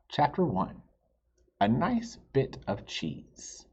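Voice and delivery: lively, expressive read-aloud; reading to children. A narrator's reading. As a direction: tell it like a picture book to kids. Reads story to children.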